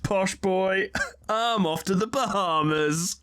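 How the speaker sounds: usual speaking voice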